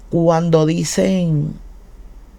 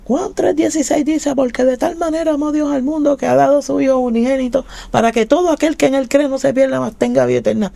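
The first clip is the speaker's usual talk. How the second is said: higher, solemn reading of the verse; scripture recitation; 7 semitones higher than usual. Acted. Cita la Biblia recitando. (recitación bíblica, más aguda)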